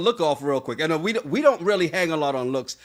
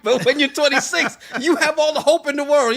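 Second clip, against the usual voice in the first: much higher and louder, laughing; bubbly mock wonder; excited teasing; 10 semitones higher, 7 dB louder than usual. Real, not acted. Teasing about youthful hope.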